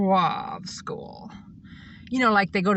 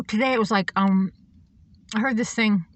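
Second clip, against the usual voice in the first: ordinary talk, nothing marked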